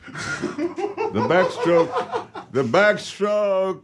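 hammed-up old comedian punchline; mock comic. Comedian hamming up a joke for the hosts, high and slow. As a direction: play it like a Borscht Belt comic. Comic bit, stand-up delivery.

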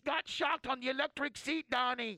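hammed-up panicked mother on the phone; mock frantic wife. Husband's friend mimics his wife calling about the electric seat, high and frantic.

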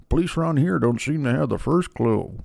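a hint of Jamie Gumb, murmured; Jamie Gumb impression. A touch of the killer talking to Clarice. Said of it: "I'm like Jamie Gumb".

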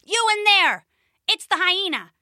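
high, loud shout into the cave; calling out. Acted. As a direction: yell it into the dark cave. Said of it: "the hyena called into the darkness".

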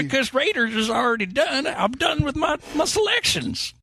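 put-on high, squeaky old prospector; cackling old-timer, way up high. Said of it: "Do the prospector voice".

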